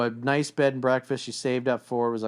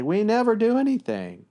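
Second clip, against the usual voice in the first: high, louder whiny complaint; girlfriend complaining; 11 semitones higher, 5 dB louder than usual. Retold or imitated. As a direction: play the upset girlfriend. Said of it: "normal and understandable to say".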